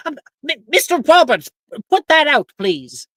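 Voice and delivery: high, loud scolding professor; shouting professor. Acted. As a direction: yell at the student to stop. Professor NPC yells at student.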